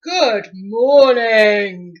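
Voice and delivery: very slow, loud trumpeted greeting; trumpeting elephant. Acted. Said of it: "The elephants would trumpet".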